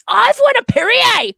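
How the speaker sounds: mock triumphant shout, high and loud; high-pitched hollered boast; [name]. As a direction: yell it out like a boastful comic's catchphrase. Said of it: "But yeah, [name]".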